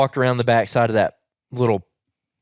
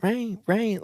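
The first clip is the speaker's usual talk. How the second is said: high, soft imitation of a small child; small, raised toddler voice, repeating; Piper; 10 semitones higher, 5 dB quieter than usual. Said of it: "she go like".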